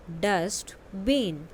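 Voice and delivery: "Dustbin" stretched slowly, syllable by syllable; slow model word. Shown as a demonstration. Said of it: "listen to your teacher and say the following word". (धीरे-धीरे खींचकर "dustbin")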